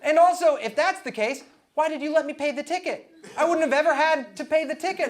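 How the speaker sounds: high, loud indignant questioning; incredulous outrage. Retold or imitated. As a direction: protest it in acted disbelief. Acted indignant reply to girlfriend's scheme.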